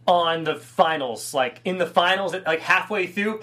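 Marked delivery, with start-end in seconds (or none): none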